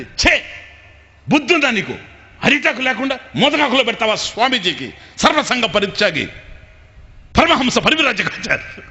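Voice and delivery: loud, high staged scolding of the wife; a put-on rage meant for the guest to overhear; mock angry shout. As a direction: bellow at her as if truly furious. Husband fakes a quarrel for guest.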